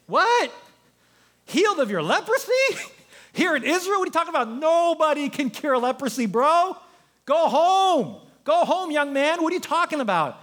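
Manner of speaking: high, incredulous, laughing it off; mock the idea of healing; a dismissive king, pitched high; scoffing king. King of Israel scoffing at Naaman.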